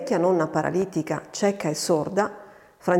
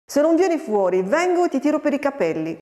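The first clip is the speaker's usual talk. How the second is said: higher-pitched scolding threat; exasperated threat; 6 semitones higher than usual. A narrator's reading. Farmhand threatens the hiding girl, exasperated threat. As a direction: threaten a disobedient child, pitch raised.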